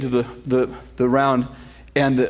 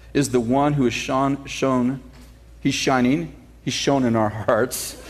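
scripture reading; 4 semitones lower than usual. Acted. Reads scripture aloud.

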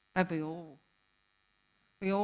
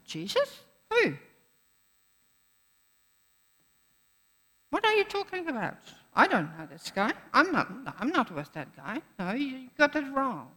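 much higher, rushed fake surprise; panicked denial; 3 semitones higher than usual. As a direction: play dumb in a panic, fast. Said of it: "And Peter's like".

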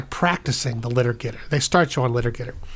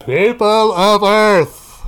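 booming alien announcement; 7 semitones higher, 9 dB louder, much slower than usual. Acted. Alien announcement over speakers.

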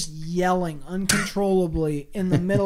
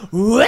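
mock yell; 2 semitones higher, 8 dB louder than usual. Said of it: "you're just".

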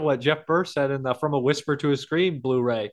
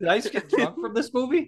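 higher, mock bewildered; dazed disbelief; 8 semitones higher than usual. Joking about bad movies to friends, higher mock bewilderment. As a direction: ask it like you're stunned and tipsy. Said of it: "You're like".

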